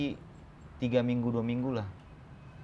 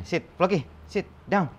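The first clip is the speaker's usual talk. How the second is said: higher-pitched, firm command to a dog; dog-training commands; 5 semitones higher than usual. Shown as a demonstration. Trainer shows how Floki plays dead, commanding. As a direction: order the dog down, word by word. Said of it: "Ya, begini".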